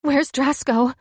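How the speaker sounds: high frightened plea; whimpering. Acted. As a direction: plead for the missing pup. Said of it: "she whimpered".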